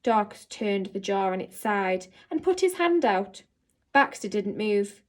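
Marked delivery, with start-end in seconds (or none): none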